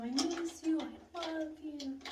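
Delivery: high, drawn-out lovey whine; mock lovesick pleading. Storyteller imitating an ex begging her back, high mock pleading. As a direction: mimic a pining ex in a high voice. Mocking ex's pleading calls.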